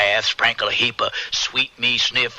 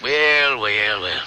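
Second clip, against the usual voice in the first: teasing; 6 semitones higher than usual. Acted. Friend teasing about courtship.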